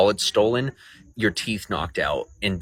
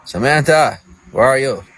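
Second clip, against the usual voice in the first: calling out; 5 semitones higher, 6 dB louder than usual. Real, not acted. Calling out for Samantha.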